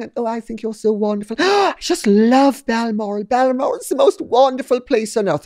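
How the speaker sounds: high, fast, breathless gushing; gushing fake adoration. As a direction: gush like an eager fiancée. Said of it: "pretend to love everything".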